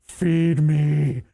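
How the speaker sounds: hungry creature demanding food; monster voice. Pretend. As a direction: growl it like a plant monster. Voicing the scanned head.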